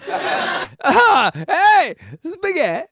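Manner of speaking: high, loud excited yell of Spaghetti!; excited shout. Real, not acted. Host shouting at a surprise coincidence, excited. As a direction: blurt it out thrilled at the coincidence.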